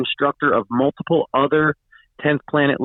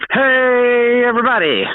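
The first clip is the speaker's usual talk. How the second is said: big, high, loud show greeting; excited announcer; 10 semitones higher, 5 dB louder than usual. Acted. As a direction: call out the welcome like a host. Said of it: "That's my line".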